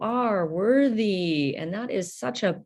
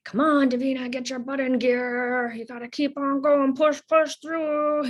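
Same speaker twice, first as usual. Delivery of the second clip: pushy mom; 7 semitones higher than usual. Retold or imitated. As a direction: imitate mom pushing her to keep going. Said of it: "my mom telling me to".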